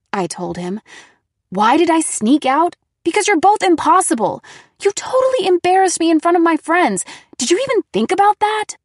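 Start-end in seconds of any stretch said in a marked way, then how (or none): 1.5-8.8 high, loud, fast tirade; angry outburst. A narrator's reading. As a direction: let it all out, furious.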